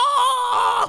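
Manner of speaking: loud, high burst of laughter. Real, not acted. Fan laughing hard at his own impression, a high loud laugh. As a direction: crack up at the bit.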